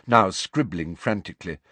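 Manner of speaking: plain reading voice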